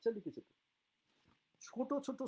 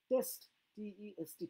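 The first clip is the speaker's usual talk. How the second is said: spelling aloud; 5 semitones higher than usual. Shown as a demonstration. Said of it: "test মানে হচ্ছে পরীক্ষা করা".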